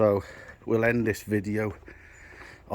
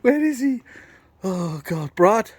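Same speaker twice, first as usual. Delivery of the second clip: calling out; 10 semitones higher, 6 dB louder, slower than usual. Real, not acted. Calling out for Brad.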